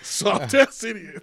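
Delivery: slow, high mocking jeer; mocking insult. Real, not acted. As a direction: drag out the insult, teasing. Mocking friend after his stunt.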